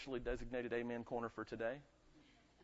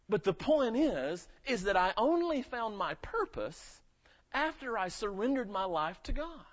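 preaching at full force; 7 semitones higher, 6 dB louder than usual. Acted. Preacher building to a question.

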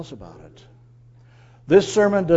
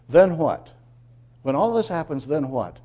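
ordinary delivery

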